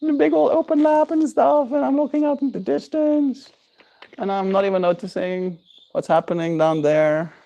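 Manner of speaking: exaggerated innocent musing, high and light; pretending not to notice. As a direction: act distracted so the dog sneaks up. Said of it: "pretend like I'm not focusing".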